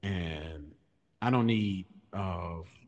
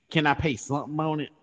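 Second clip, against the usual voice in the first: high, louder mock plea; pleading customer; 8 semitones higher, 6 dB louder than usual. Woman on call from power company, mock pleading. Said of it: "they'll say some shit like".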